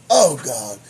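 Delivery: ordinary talk, nothing marked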